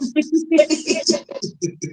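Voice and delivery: high, soft laughter. Real, not acted. Co-host laughing at the story, high soft laugh.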